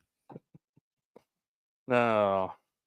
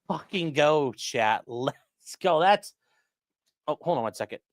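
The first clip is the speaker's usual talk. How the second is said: ordinary talk, nothing marked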